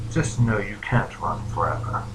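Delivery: low, soft, slow phantom voice; slow it down into a quiet threat; smiling menace. Acted. Phantom warning the dreamer before vanishing, low and slow. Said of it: "He simply smiles and says".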